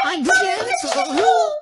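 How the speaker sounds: high, slow yell of "I'm gentle"; mock shouting. Girlfriend yells "I'm gentle" to tease her boyfriend, shouting. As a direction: shout that you're gentle, ironically. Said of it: "I'm nice about it".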